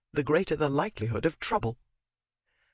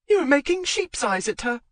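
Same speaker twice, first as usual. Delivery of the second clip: high, louder jealous jab; jealous accusation; 12 semitones higher, 3 dB louder than usual. Acted. Wife in corridor accusing husband, jealous high voice. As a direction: accuse him shrilly.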